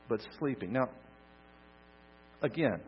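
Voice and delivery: ordinary talk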